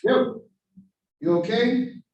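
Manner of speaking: loud, high call to wake someone; loud checking call; calling out loudly. Shown as a demonstration. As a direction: shout it up to get a response. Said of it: "So noise is".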